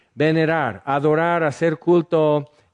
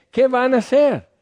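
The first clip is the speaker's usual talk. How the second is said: much higher, scoffing question; God's mocking laugh; 10 semitones higher, 3 dB louder than usual. God scoffing at the plotting nations, high mocking question. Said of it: "y Dios se ríe de ellos".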